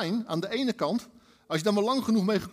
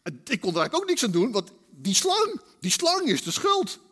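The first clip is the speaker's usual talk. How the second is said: Eve blaming the snake; 3 semitones higher, 4 dB louder than usual. Retold or imitated. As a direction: point at the snake, indignant. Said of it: "zegt de vrouw".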